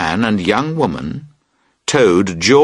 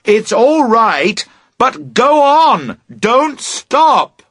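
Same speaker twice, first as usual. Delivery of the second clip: slow, high, loud urging; shouting; 11 semitones higher, 3 dB louder, much slower than usual. A narrator's reading. Boat owner shouting slowly to Emily, loud. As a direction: drag it out loud so she gets it.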